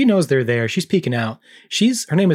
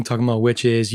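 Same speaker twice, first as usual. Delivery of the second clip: usual speaking voice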